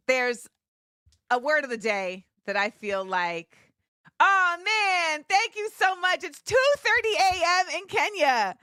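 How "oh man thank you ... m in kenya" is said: high, animated gratitude; excited thanks. Real, not acted. Streamer thanking a viewer up late in Kenya, excited thanks. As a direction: gush thanks, pitched up with amazement.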